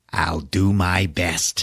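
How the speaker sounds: slow, drawn-out sinister doll voice; Chucky. Retold or imitated. Evil doll answering the woman he hugs, slow menace. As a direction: creep through it at half speed. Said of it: "Fucking evil Chucky doll".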